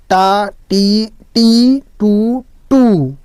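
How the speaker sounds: "टी" and "टू" stretched very slow; drawn-out syllable demo. Shown as a demonstration. Teacher to pupils, pronouncing each syllable slowly. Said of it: "आपको उच्चारण करके बता देते हैं".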